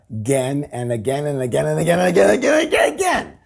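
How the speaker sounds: a breathless run of agains; rapid-fire repetition. Shown as a demonstration. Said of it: "help me out here".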